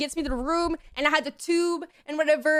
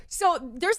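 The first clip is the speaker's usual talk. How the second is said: ordinary talk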